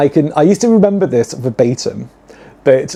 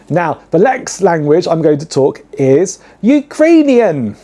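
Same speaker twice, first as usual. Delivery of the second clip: ordinary talk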